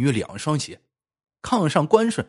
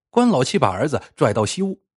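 ordinary delivery, nothing marked

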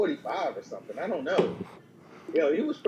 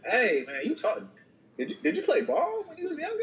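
high, fake-innocent question to a star; innocent fan question; 11 semitones higher than usual. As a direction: ask sweetly, playing dumb. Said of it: "If I'm just like".

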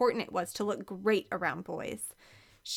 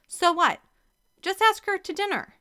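loud, higher insistence; exasperated push; 10 semitones higher, 7 dB louder than usual. Acted. Carrie pushing Kevin to ask Stephanie to dinner, loud. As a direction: push him, fed up.

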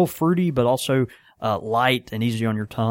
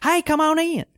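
high, repeated mock welcome; mimicked greeting. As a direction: parrot the shopkeeper's hello. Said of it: "they were just like".

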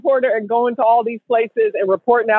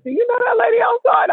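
put-on ex-boyfriend voice; 12 semitones higher than usual. Ex-boyfriend alarmed about a stranger, high shout. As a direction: yelp it out in alarm. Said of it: "And he was like".

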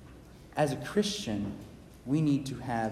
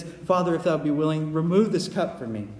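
louder, raised pleading prayer; pleading Jesus; 3 semitones higher, 4 dB louder than usual. Acted. Jesus pleading with the Father, raised and earnest. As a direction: beg the Father, voice lifted. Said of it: "he says".

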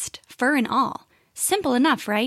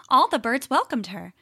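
plain reading voice

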